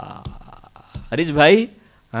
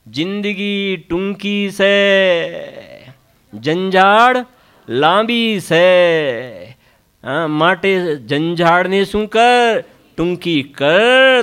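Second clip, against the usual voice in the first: drawn-out, weighty recitation of a saying; slowly, letting each phrase sink in; quoting a saint's maxim, measured and slow; slow quoted maxim; much slower than usual. Retold or imitated. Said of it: "આ શ્રીમદજીનું એક વાક્ય છે".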